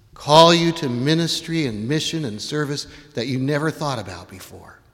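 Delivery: normal talking voice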